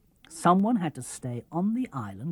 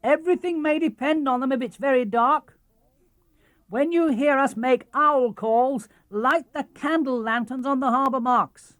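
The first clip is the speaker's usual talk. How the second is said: high, louder call across the water; captain calling back to Titty; 11 semitones higher, 5 dB louder than usual. Acted. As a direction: call back to the shore from the boat. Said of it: "he called".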